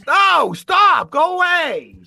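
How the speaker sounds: high-pitched shouted protest; exasperated mock shout. Bostonian fending off accent requests, exasperated shout. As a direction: shout them off in comic exasperation. Acted rebuff to pestering accent fans.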